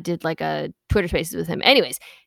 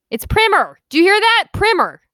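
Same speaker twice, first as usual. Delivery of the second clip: loud, high crowing over being proved right; triumphant, pitched way up and punched; gloating triumph; 12 semitones higher, 7 dB louder than usual. Real, not acted. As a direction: crow "PRIMM-er" high and loud in victory. Triumphant after looking it up.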